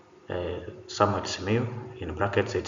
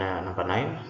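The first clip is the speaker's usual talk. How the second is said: usual speaking voice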